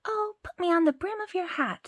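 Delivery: put-on high, small boyish voice; tiny Tom Thumb voice. Tom Thumb asks his father, high and small. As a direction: pipe up like a tiny boy.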